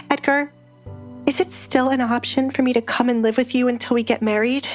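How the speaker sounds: Alessandra, wounded. Acted. Fiancée asking the Duke to take her in, wounded. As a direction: voice a wronged fiancée pleading her case.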